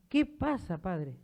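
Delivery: high-pitched imitation of a worried nun; alarmed prioress. Prioress storming in to question the priest, imitated alarm. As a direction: voice a startled, upset prioress. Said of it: "Se puso a llorar y dice".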